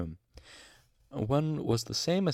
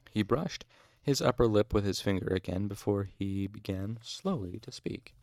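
ordinary delivery

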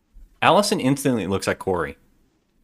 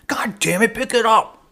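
high, loud imitation of a furious doctor; retold angry scream; 13 semitones higher, 4 dB louder than usual. Doctor screaming at her before the patient, acted scream. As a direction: mock-scream the order, pitched up and loud. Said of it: "screaming at her".

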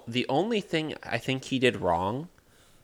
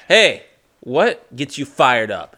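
coach voice; 3 semitones higher, 10 dB louder, slower than usual. Retold or imitated. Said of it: "He was like".

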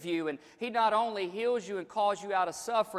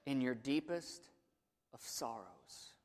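dropping to a near whisper for effect; hushed, breathy preacher's whisper; 6 semitones lower, 12 dB quieter than usual. Real, not acted. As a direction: pull them in with a hush. Quiet dramatic sermon climax.

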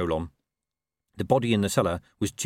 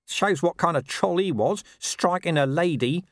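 grumbled aside in a raised pitch; muttered complaint; 9 semitones higher than usual. A narrator's reading. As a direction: grumble it, dragging out the last words. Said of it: "muttered Nobby".

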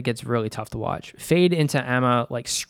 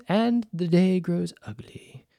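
quoting Rhaenys; 6 semitones higher, slower than usual. Retold or imitated.

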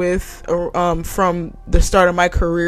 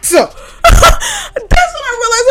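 high, loud, slow words through a laugh; laughing shout; 6 dB louder, much slower than usual. Real, not acted. Host recounting the moment to listeners, laughing loudly. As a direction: crack up while shouting it. Laugh-yelling while telling story.

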